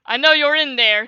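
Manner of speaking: loud call into the cave; loud booming voice. Acted. Enemy dragon calling into the cave, loud and booming. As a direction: boom it out at the hiding family. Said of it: "came a loud, booming voice".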